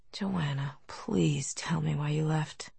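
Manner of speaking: soft slow whisper; pleading whisper. Acted. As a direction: beg her gently, barely audible. He pleads softly for explanation.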